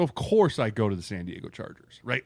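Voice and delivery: usual speaking voice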